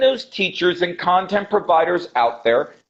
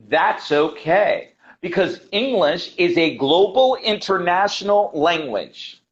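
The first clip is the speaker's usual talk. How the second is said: usual speaking voice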